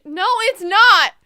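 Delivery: loud, drawn-out protest; exasperated yell. Real, not acted. Player yelling advice at the game, exasperated shout. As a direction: yell slowly at the characters.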